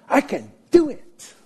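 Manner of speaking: high, boastful "I can do it." with a rising end; cocky self-reliance. Shown as a demonstration. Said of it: "That's one way to say it".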